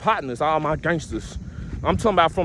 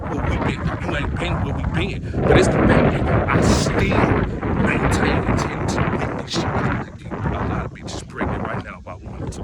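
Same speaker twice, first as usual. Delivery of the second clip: hushed near-whisper. Real, not acted. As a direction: drop to an unvoiced near-whisper, confiding. Serious point dropped to near-whisper.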